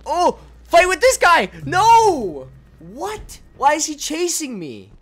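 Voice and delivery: high, loud alarmed shouts; panicked yelling. Real, not acted. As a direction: yell in real panic at the screen. Panicked chase in game.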